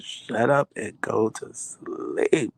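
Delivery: slow, stretched-out scolding; drawn-out exasperation. Retold or imitated. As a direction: drag each word out, ending on a long sleep. Wife's worn-out repeated scolding.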